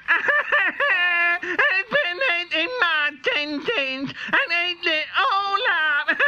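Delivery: put-on very high, very slow crying baby voice; a baby dinosaur bawling over lost dinner; wailing baby dinosaur. A narrator's reading. Baby dinosaur cries that her din-dins are gone, very high wailing. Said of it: "And the baby dinosaur said".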